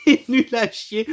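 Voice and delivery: mocking laugh; laughing scorn. Real, not acted. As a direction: crack up while trashing the film.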